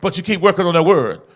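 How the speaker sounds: loud, rolling sermon cadence; impassioned preacher in full flow; full-force preaching. Real, not acted.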